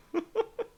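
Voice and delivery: short high-pitched laugh. Real, not acted. Player laughing at own flat jokes, high squeaky laugh.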